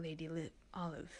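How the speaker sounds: ordinary delivery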